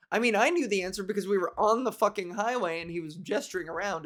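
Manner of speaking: normal talking voice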